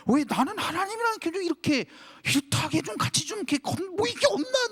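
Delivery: 아주 높게 떼쓰듯 하소연; 징징대듯 높이 끌어올린 하소연; whiny pleading. Retold or imitated. Peak of frustrated whining. (높은 음으로 징징대는 하소연)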